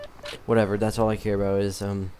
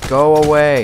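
loud, raised-pitch yell; angry shout; 4 semitones higher, 12 dB louder than usual. Real, not acted. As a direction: shout them off at full volume. Yelling at an attacker in game.

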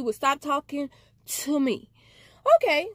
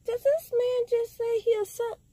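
much higher, stunned disbelieving voice; mock outrage, high and quick; shocked disbelief; 10 semitones higher than usual. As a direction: retell your own jaw-drop, pitched way up. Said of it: "And I was like".

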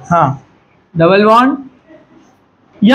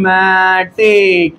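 very slow, every syllable stretched; drawn-out dictation; 3 semitones lower, much slower than usual. Real, not acted. Dictates term slowly while writing.

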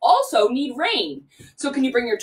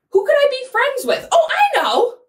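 excited wondering; 11 semitones higher, 4 dB louder than usual. Acted. Playful wondering to kids.

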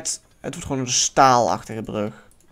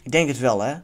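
ordinary talk, nothing marked

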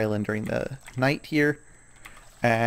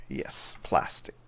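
whispered aside; 10 semitones lower, 6 dB quieter, slower than usual. Real, not acted. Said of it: "quiet aside to self".

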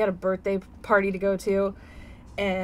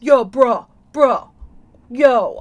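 louder mock gamer kid; 10 dB louder, much slower than usual. Mom imitating her son's Fortnite talk, louder. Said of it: "he's been playing Fortnite".

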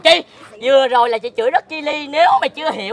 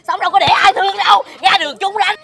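raised, higher-pitched insult; loud scolding; 5 semitones higher, 5 dB louder than usual. Acted. Neighbour trading insults with Tám Thu, loud scolding. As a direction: throw the taunt out loud and high.

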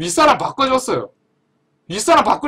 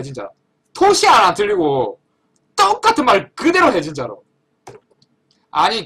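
ordinary talk, nothing marked

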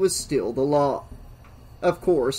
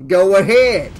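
loud, sneering dare; mocking sheriff egging him on; 5 semitones higher, 10 dB louder than usual. A narrator's reading. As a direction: sneer it at full voice, pitched up. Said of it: "sneered the sheriff".

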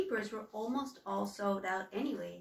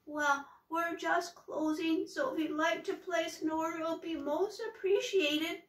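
frightened little troll voice; trembly wobbly voice; 8 semitones higher, 4 dB louder than usual. Acted. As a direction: stammer it out scared of the witch. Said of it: "In a trembly, wobbly voice".

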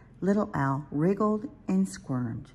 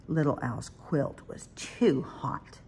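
ordinary delivery

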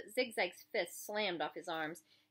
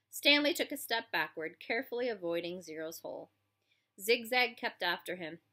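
usual speaking voice

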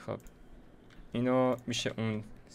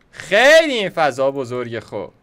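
louder, slow and drawn out, really fed up; loud slow complaint; 3 semitones higher, 11 dB louder, slower than usual. Real, not acted. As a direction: stretch the words out in loud annoyance. Complaining the enclosure is huge. (با صدای بلند و کشیده، کلافه از بزرگی فضا)

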